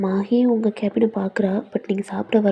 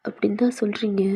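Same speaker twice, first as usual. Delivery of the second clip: ordinary delivery, nothing marked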